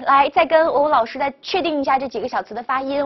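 normal talking voice

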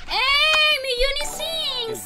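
high, loud, stretched greeting; excited shout-out. Real, not acted. Streamer greets a donor by name with a loud, stretched shout. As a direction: call out the name with big delight, slowly.

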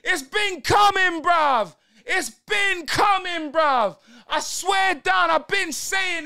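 a fan roaring at the screen over a goal; excited shout. Real, not acted. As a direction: yell it out as the goal goes in. Team scores, he celebrates.

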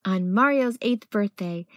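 plain reading voice

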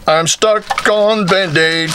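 ordinary talk

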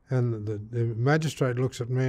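ordinary delivery

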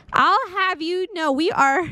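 high, haughty protest; playful, high-voiced huff; mock indignation. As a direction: pitch it up like an offended character. Voicing indignant character, second take higher.

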